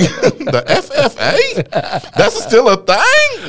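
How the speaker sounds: high-pitched, drawn-out disbelief; incredulous. Retold or imitated. Comedian reacting to a farmers' club gig, incredulous. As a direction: squeal it slowly in mock amazement.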